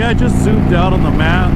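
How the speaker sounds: normal talking voice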